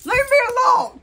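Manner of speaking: very high, loud enraged scream; furious scream. Real, not acted. Grandma at breaking point over keys, furious scream. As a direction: scream at them to back off. Real quarrel, being followed.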